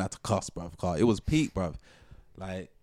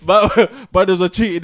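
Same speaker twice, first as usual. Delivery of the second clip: laughing through the words, high and loud; laughing while telling the fight story; 10 semitones higher, 13 dB louder than usual. Real, not acted. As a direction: giggle it out.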